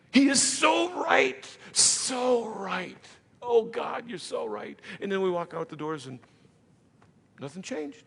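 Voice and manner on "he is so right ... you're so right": high, slow gushing agreement; lightly overdone swoon at the sermon; a hint of a moved churchgoer; mock emotional worshipper.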